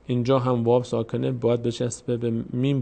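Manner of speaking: ordinary talk, nothing marked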